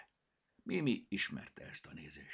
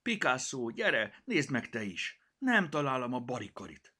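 calling out upset; 5 semitones higher, 5 dB louder than usual. Acted. Mimi calls the cat, upset.

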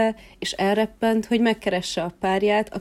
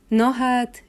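higher, louder wondering cry; surprised exclamation; 5 semitones higher, 4 dB louder than usual. Acted. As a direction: gasp in delighted surprise. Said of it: "kiáltott fel".

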